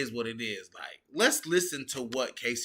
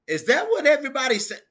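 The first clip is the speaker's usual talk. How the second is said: quick, high, louder disbelief; incredulous; 12 semitones higher, 6 dB louder, faster than usual. Real, not acted. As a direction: scoff at the chat in disbelief. Streamer scoffing at chat claim.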